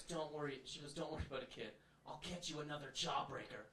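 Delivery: put-on calm the child gently and quietly; soothing mom. Mom soothing kid, acted.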